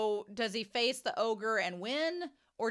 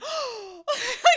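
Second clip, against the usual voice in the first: high theatrical gasp; mock offended gasp; 13 semitones higher, 9 dB louder than usual. Imitating Shin's face, mock scandalized gasp. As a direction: gasp as if insulted.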